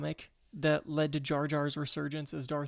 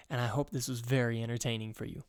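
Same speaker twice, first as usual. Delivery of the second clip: ordinary delivery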